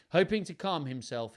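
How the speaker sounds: ordinary delivery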